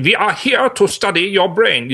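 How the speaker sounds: high, slow, over-the-top movie-Nazi German accent; stringent German accent. Shown as a demonstration. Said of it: "that very sort of stringent".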